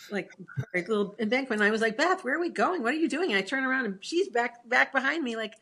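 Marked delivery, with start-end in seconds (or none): 2.0-3.3 higher-pitched retold call of alarm; mock alarm. Retelling calling to Beth while rolling downhill, mock alarm. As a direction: pitch it up, mildly panicked.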